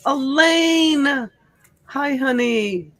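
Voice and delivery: loud, high greeting call. Real, not acted. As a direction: call her name across the room. Greeting a viewer arriving in chat.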